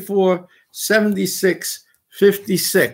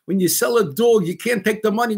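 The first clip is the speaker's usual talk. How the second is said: ordinary talk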